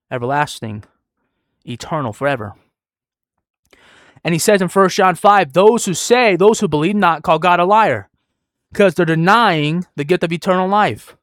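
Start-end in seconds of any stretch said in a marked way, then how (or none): 4.2-11.2 high, loud pulpit accusation; forceful preaching. Real, not acted. Preacher citing First John against deniers, forceful preaching. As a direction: denounce them with raised voice.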